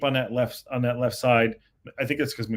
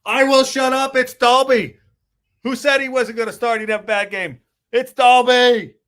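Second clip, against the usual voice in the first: goal celebration yell; 10 semitones higher, 7 dB louder than usual. Real, not acted.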